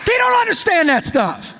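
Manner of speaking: very high, comic squeal; exasperated punchline. Acted. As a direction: pitch it way up in mock exasperation. Punchline after mock pious speech.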